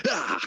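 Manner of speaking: sudden very high yelp; mock yelp. Friend imitating Pedro Pascal's cry with a very high yelp. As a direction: let out a squeaky cry. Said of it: "just goes".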